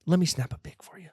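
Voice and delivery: hushed sales pitch to a guest; conspiratorial whisper; whispered coaxing. Pretend. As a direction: whisper it, quiet and persuasive. Coaxing a guest to wear chain.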